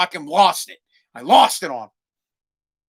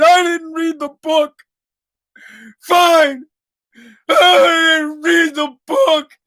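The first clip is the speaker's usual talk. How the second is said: high, wailing mock sob, drawn out; imitating son crying; 9 semitones higher, 5 dB louder, much slower than usual. Dad mimicking his son crying after being caught. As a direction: blubber it like a caught kid. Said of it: "And he starts crying".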